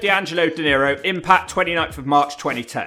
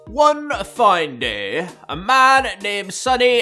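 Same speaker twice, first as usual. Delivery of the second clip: erotic novel narration; 5 semitones higher, much slower than usual. Pretend. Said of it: "do a narration of erotic novels".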